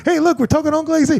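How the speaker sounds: high-pitched imitation of eager relatives; retold calling out. Family hailing Ducka D during a FaceTime, calling out. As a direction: call someone over to join the chat.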